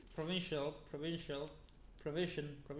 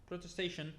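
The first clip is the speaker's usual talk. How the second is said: normal talking voice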